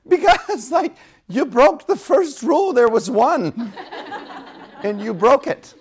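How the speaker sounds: high, loud mock telling-off; hammed-up exasperated angel; exasperated scolding. Acting angel scolding Joseph.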